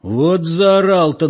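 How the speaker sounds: slow, impressed exclamation; marvelling onlooker. A narrator's reading. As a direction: marvel at the racket.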